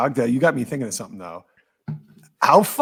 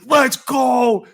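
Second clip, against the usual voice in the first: loud, high rallying shout of let's go; 9 semitones higher, 6 dB louder than usual. Retold or imitated. Manager yelling to the bullpen, a loud high rallying shout. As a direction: fire up the bullpen with a yell. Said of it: "He's like".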